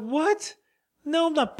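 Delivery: high-pitched incredulous "what"; mock disbelief. Retelling his shock at Ron's confession, high incredulous yelp. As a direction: shoot the pitch right up, incredulous. Acted shocked reply to Ron.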